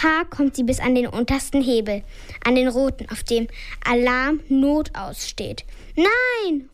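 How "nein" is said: high alarmed cry; a light startled no; mock shout. A narrator's reading. Father tries to stop Nina pressing the alarm, high alarmed cry. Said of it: "ruft Papa".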